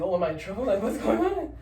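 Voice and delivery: high, loud and panicky, with a nervous laugh; retelling his own freak-out in a squeaky voice; mock panic. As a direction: act scared of being in trouble. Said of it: "I was freaking out".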